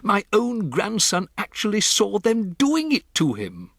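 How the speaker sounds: put-on a composed old grandmother breaking odd news; grandmother's voice. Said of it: "my grandmother said".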